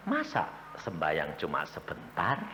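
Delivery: low, soft voice of God, gently chiding; a low, hushed divine rebuke; God speaking. Acted. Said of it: "Tuhan malah ngomong". (suara Tuhan yang rendah dan pelan, menegur)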